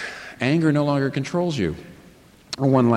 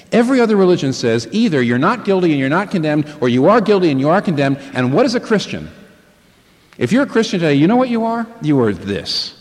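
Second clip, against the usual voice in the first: louder, higher preaching build; preacher rising to the point, louder and higher; full-force preaching; 6 semitones higher, 6 dB louder than usual. Acted. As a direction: preach it out to the whole congregation. Preaching climax on guilt.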